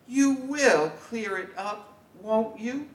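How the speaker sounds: high, coaxing mother voice; coaxing mom. Acted. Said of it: "Mom says".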